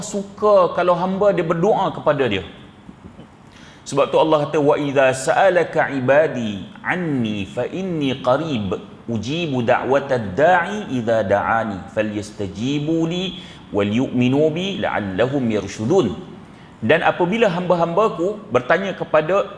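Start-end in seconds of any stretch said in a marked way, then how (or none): 4.6-16.1 Quran recitation. Acted. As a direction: recite the verse with reverence. (bacaan ayat Quran yang tenang)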